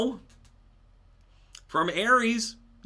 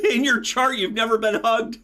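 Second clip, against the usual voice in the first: laughing retold jab; 5 semitones higher, 5 dB louder than usual. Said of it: "then she goes".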